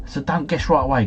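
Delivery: normal talking voice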